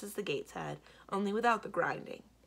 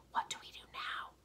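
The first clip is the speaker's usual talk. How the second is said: whispered question; said softly; 7 dB quieter than usual. A narrator's reading. Mendibar consults Simrine at the gate, very softly. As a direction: ask it under your breath. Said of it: "Mendibar said softly to Simrine".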